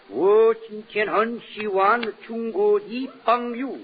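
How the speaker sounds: slow, sing-song mock Chinese gibberish; comic fake Chinese phrase, said very slowly. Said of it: "in Chinese it would go".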